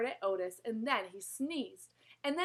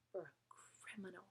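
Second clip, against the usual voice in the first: whisper; 4 semitones lower, 18 dB quieter than usual. A narrator's reading. Narrator whispers the word.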